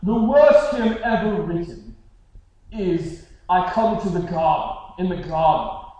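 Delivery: imitated noble British accent, loud and pompous; stuck-up British accent. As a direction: play a snooty British academic accent, hammed-up. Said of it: "like, that British noble accent".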